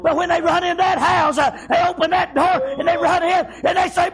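driving, chanted sermon storytelling; full-force preaching. Real, not acted. Preacher tells of children running home, urgent shouted cadence. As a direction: build momentum in shouted, rhythmic phrases.

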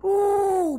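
high, held "ooh" imitating a sudden sound; acted-out sudden call from behind. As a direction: break in with a long high whoop. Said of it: "all of a sudden".

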